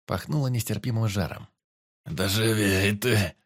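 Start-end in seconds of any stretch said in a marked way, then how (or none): 2.1-3.3 slow, pained, louder urging; moaned. A narrator's reading. Wounded forester urges the teacher on, groaning. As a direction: groan it out through the pain. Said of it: "простонал Сарафанов".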